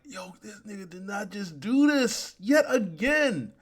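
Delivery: high, incredulous outburst; mock disbelief. Onlookers reacting to the impostor's trick, mock disbelief. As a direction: pitch it up in hammed-up outrage.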